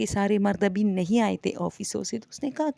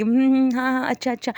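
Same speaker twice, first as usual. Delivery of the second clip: mimicking a distracted mum brushing off a child, louder; half-listening hm, hm, yes, okay okay; busy mother humouring her son; absent-minded hmm-hmm; 4 semitones higher, 5 dB louder than usual. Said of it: "صرف ہاں ہوں کی آواز دیتی".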